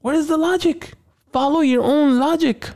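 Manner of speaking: high, exasperated questioning; incredulous rant. Real, not acted. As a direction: demand where the logic is. Ranting about ghosts logic.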